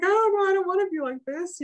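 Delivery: high, laughing mock whimper; a light, hammed-up teary whine through laughter; mock childish wail. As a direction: play your child self sobbing, laughing at it. Mimics herself crying as child.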